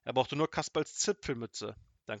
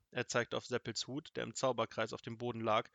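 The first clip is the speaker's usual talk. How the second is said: ordinary delivery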